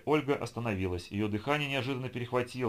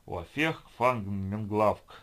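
slow gibberish; 4 dB louder, much slower than usual. Acted. Gibberish in a made-up tongue.

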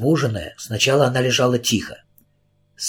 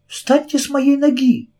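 high pleading; 13 semitones higher than usual. A narrator's reading. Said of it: "снова попросила Кэрри".

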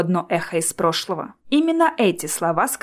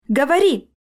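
higher-pitched, mildly snarled command; growled order; 6 semitones higher, 4 dB louder than usual. A narrator's reading. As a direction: snarl the demand at him. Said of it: "рычал Джоплин". (рычащий приказ повышенным тоном)

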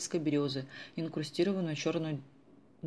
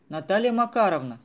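loud call across the shop, higher; 4 semitones higher, 9 dB louder than usual. Acted. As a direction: shout her name to summon her. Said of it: "крикнул он". (громкий зов через магазин)

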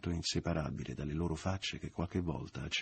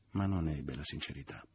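plain reading voice

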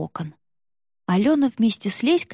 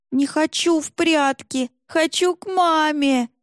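put-on slow, higher, nearly sobbing child; little girl on the verge of wailing; whiny tattling little sibling; 8 semitones higher, slower than usual. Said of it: "почти в голос зарыдала Танечка".

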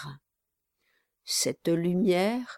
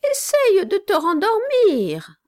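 ordinary delivery

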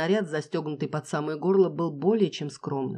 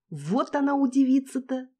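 high gleeful; 9 semitones higher than usual. Acted. Gleeful continuation.

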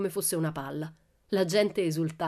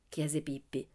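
ordinary delivery

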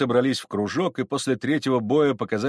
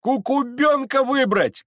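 very high, slow, loud yell; drawn-out, high-pitched cry from the crowd; crowd shout; 14 semitones higher, 5 dB louder, slower than usual. Acted. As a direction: call out your candidate at full voice. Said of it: "кричала часть".

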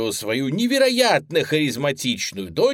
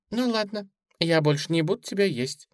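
lightly voiced child giving in; a hint of a small girl, slightly higher; little girl relenting; 7 semitones higher, faster than usual. A narrator's reading. Suzy pretends to relent.

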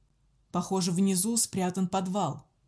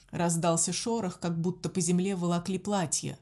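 ordinary delivery

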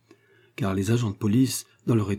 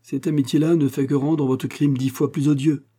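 raised, severe rebuke; stern; 6 semitones higher, 4 dB louder than usual. A narrator's reading. Inspector rebuking the student for his crime, stern. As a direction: reprimand him sternly, pitched up. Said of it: "dit sévèrement l'inspecteur".

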